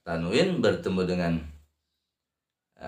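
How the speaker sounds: ordinary delivery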